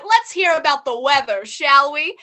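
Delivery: ordinary delivery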